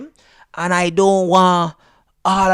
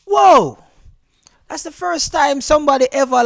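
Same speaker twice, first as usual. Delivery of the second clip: high, loud startled whoa; surprised whoa; 9 semitones higher, 5 dB louder than usual. Retold or imitated. Called Haitian for the first time, startled whoa. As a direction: caught off guard, loud and high.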